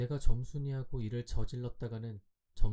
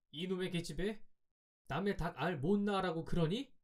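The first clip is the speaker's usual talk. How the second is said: higher-pitched indignant yell, held back in volume; understated narrated scolding, pitched up; mock angry yell; 7 semitones higher than usual. A narrator's reading. Boy to the girl hurting his hen, angry yell. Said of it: "소리를 빽 질렀다".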